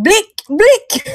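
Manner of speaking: high-pitched mock yell, breaking into laughter; imitated yelling. Host copies partner yelling from the other room, mock yell. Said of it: "in the other room yelling out".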